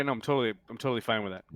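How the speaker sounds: ordinary delivery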